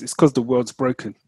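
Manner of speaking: ordinary talk, nothing marked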